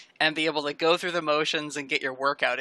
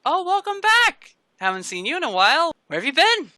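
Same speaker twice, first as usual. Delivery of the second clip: bright, high-pitched bubbly greeting; excited, friendly squeal of welcome; 13 semitones higher, 7 dB louder than usual. Acted. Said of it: "Noriko is very bubbly and friendly".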